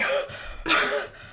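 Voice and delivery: sharp, high gasp; waking gasp. Acted. Game master as Brogel waking up, startled gasp. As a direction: gasp awake from a nightmare.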